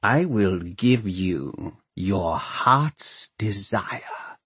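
slow, weighty lord mayor; drawn-out, deliberate pledge; solemn promise. A narrator's reading. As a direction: spell out the reward slowly. Lord mayor promising creature its reward.

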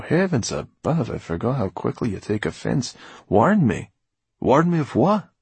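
high-pitched, loud exclaiming; exasperated, swooping questions. Acted. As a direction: pull him up, impatient and incredulous. Exasperated at the boy taking offense.

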